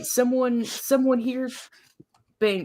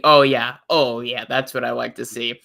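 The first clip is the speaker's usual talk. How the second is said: lower, smug mock voice; mock satisfied viewer; 8 semitones lower, 3 dB louder than usual. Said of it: "it's gonna be".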